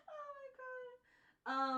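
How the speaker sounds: high drawn-out laughing wail; squealing laugh. Real, not acted. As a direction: let it out as a laughing cry. Laughing at dirty joke.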